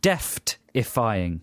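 "Death-defying" slowly, as DEFT-defying. Shown as a demonstration. Demonstrating the misheard deft-defying.

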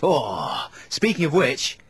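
ordinary delivery

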